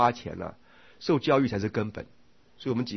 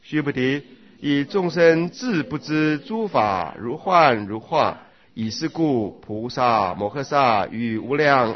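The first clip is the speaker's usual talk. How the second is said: slow, loud, measured scripture recitation; sutra reading; 2 semitones higher, 5 dB louder, much slower than usual. Acted. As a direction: read the sutra out slowly and forcefully, drawing out the phrases. Reads sutra passage aloud. (诵读经文，缓慢而洪亮)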